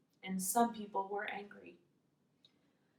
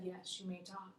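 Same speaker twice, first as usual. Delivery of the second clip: very soft granting of permission; hushed reply; 14 dB quieter than usual. Acted. The leader giving Paul permission to talk, hushed reply.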